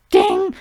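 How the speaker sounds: short ringing "ding" sound effect; voiced tinny ding; imitated metal ding. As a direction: mimic cheap tin striking a desk. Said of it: "cheap metal that makes a".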